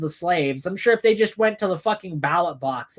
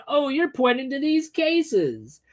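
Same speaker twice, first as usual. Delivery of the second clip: mocking imitation; 8 semitones higher, slower than usual. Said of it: "You're just going".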